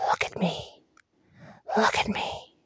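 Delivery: hollow breathy whisper, very soft; creature whisper. Acted. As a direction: lure them in a ghostly whisper. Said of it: "hollow voice whispered".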